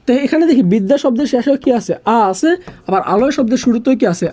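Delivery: ordinary talk, nothing marked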